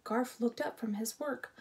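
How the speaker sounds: ordinary delivery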